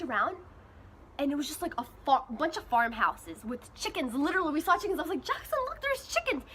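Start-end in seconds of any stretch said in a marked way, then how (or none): 5.2-6.4 high, eager call to look; excited. Retold or imitated. Telling Jackson to look at the chickens, high and excited. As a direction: point it out with delight.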